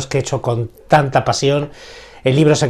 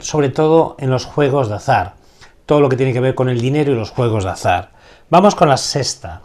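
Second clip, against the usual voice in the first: ordinary talk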